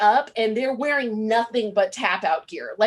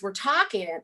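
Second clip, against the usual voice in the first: ordinary talk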